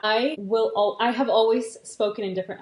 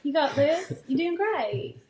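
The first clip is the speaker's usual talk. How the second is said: higher-pitched country sweetheart cheerleading; country accent; 5 semitones higher, slower than usual. Pretend. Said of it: "Okay, I'll stop".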